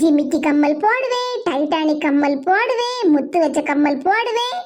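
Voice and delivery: mocking sing-song brag; mock boasting. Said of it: "அப்படின்னு என் மைனிக்காரி எவ்வளவு தூரம் பீத்திக்கிட்டு இருந்தா".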